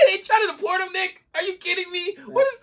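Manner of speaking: high laughing are-you-kidding-me disbelief; incredulous outburst. Real, not acted. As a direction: squeal it in laughing outrage. Outraged disbelief at deportation.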